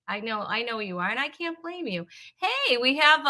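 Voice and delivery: ordinary delivery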